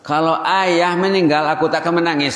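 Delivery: put-on a child stating it to father; child's blunt words.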